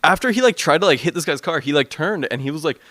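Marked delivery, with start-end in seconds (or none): none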